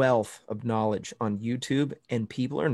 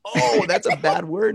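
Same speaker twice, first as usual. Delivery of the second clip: higher, louder, slow "oh" of dawning realization; mock realization; 7 semitones higher, 6 dB louder than usual. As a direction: stretch it out like it just clicked. Realizes what lady meant.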